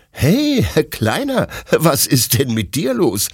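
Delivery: high, amused greeting to the puppy; laughing question. A narrator's reading. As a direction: chuckle it at the little dog. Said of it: "fragt Detlef lachend".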